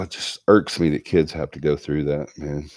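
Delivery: ordinary delivery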